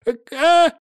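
high air-escaping squeak; strangled squeak. Acted. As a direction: squeak like a leaking balloon. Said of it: "вырвалось у него из разинутого рта".

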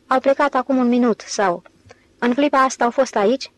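ordinary delivery, nothing marked

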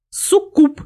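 high, loud cry of delight; overjoyed. A narrator's reading. Никсар spots a live succubus, overjoyed. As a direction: exclaim it with gleeful surprise. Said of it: "обрадовался Никсар".

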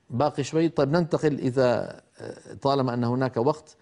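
ordinary talk

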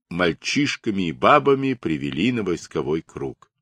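ordinary delivery, nothing marked

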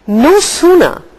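plain reading voice

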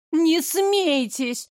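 high, slow, vexed outburst; stamping in anger. A narrator's reading. Blonde stamps her foot at the mocking mayor, high vexed outburst. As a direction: stamp your foot and snap. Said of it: "в сердцах топнула блондинка".

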